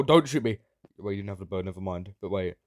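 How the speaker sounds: usual speaking voice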